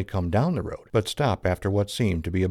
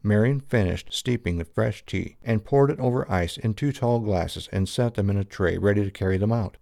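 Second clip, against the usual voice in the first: usual speaking voice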